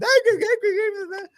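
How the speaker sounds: high, loud cackling laugh; loud cackle. Real, not acted. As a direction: cackle from the gut. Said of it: "cackling out there".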